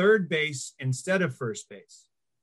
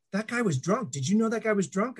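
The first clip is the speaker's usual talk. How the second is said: a hint of a cross teacher, pitched higher, faster at the end; mock scold, pitched up and hurried; 7 semitones higher than usual. Angry teacher scolds the boy.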